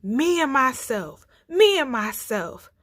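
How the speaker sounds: lion's roar. A narrator's reading. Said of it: "They heard it plain now".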